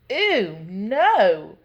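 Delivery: loud, swooping exclamation of distaste; disgusted cry. A narrator's reading. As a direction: recoil loudly from a too-sweet taste. Second bad taste, exclaimed.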